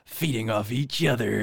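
slow, drawn-out show title; slow intro voice. Shown as a demonstration. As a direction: announce it like a podcast intro. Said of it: "wh-, how did you say it?".

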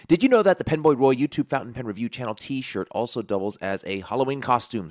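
upbeat scripted sales question; ad read. Acted. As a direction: open the plug like an announcer.

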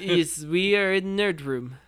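slow, heavy mock Russian accent; hammed-up Russian like Mickey Rourke. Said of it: "I'm gonna say this whole thing in a Russian accent".

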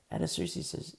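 normal talking voice

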